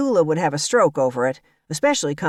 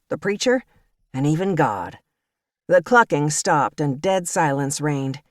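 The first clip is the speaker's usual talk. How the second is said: usual speaking voice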